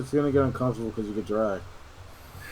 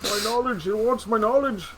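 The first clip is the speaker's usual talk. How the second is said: fish voice; 9 semitones higher, slower than usual. Retold or imitated. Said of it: "repeats the fish's line".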